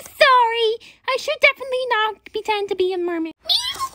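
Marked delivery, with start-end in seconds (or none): 3.4-3.9 tiny, very high soft meow; squeaky little cat voice; toy cat. Acted. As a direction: mew like a little kitten.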